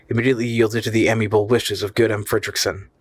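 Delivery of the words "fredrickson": usual speaking voice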